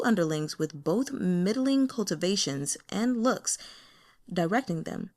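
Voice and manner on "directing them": ordinary delivery, nothing marked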